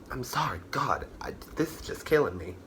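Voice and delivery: tired, unvoiced whisper; breathless whisper. Real, not acted. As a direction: whisper it, worn out from the haul. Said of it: "I am getting out of breath".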